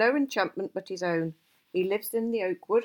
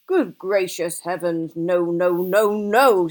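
loud, drawn-out exasperated protest; appalled outburst; 9 dB louder, slower than usual. A narrator's reading. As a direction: cut him off, loudly aghast. Gandalf appalled at Bilbo's guess.

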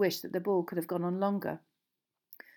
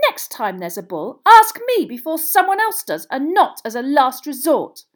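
building to a high, full-voiced yell; furious bellowing; 7 semitones higher, 15 dB louder than usual. A narrator's reading. Hermione tells Ron off about the ball, furious bellowing. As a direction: let them have it at the top of your voice. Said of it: "they were bellowing at each other".

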